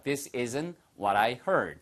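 very slow, every word clear; this isn't what I ordered, drawn out for repeating; slow model sentence. Shown as a demonstration. Teacher modelling the sentence slowly for learners. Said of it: "your turn".

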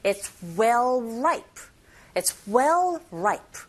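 very slow It's well ripe with dark L; slow model sentence. Shown as a demonstration. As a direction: drawl it out word by word for copying. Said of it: "이렇게 발음하셔야 되거든요". (아주 천천히 시범 보이는 발음)

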